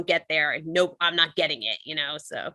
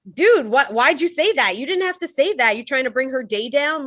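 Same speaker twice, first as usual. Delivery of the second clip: higher, calling him out; dude, why'd you say that, pitched up; imagined confrontation; 7 semitones higher, 4 dB louder than usual. Retold or imitated. Confronting the customer in an imagined scene, higher and heated. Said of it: "I'm getting angry at myself".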